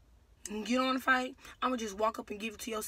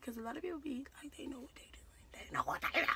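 soft unvoiced whisper; hushed confidential whisper; whispered aside; 6 dB quieter, much faster than usual. Real, not acted. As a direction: breathe it like a secret. Aside about people faking anger.

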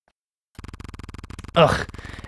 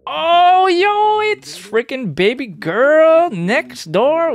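excited greeting; 11 semitones higher, 5 dB louder, much slower than usual. Acted. Flirty greeting at the door.

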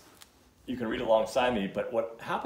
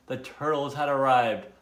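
ordinary delivery

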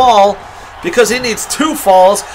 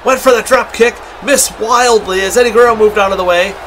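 ordinary delivery

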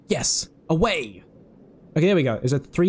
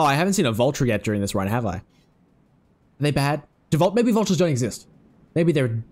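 ordinary talk

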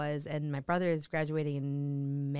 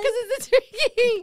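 laughing; 8 dB louder than usual. Real, not acted. Both cracking up.

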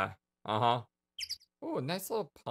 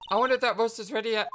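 put-on perky girl voice; 10 semitones higher, 4 dB louder, faster than usual. Perky sidekick voice.

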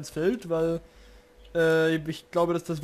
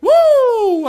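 high loud whoop of joy; triumphant cheer; 15 dB louder than usual. Real, not acted. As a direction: whoop like you just won. Portal worked.

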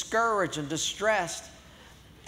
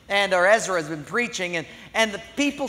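ordinary talk, nothing marked

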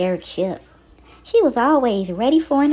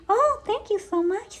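grateful; 11 semitones higher, 3 dB quieter than usual. Acted. Teacup thanks Petite gratefully.

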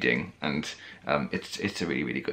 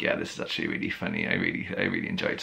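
low retold aside; 5 dB quieter, faster than usual. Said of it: "I was like".

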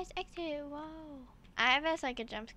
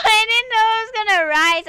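panicked wail; 8 semitones higher, 16 dB louder, faster than usual. Real, not acted. Repeats it after the panic.

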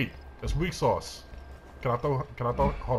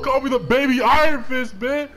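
high, loud crowing; hyped boast; 11 semitones higher, 7 dB louder than usual. Real, not acted. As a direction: crow about a winning streak. Boasting after landing hits.

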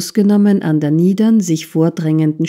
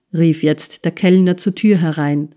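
plain reading voice

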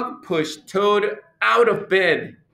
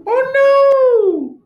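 very high, loud squeal; mock alarm; 8 dB louder than usual. Reader reacting to the torn calendar, high mock alarm. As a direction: squeal in exaggerated dismay.

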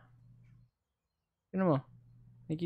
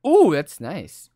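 ordinary talk, nothing marked

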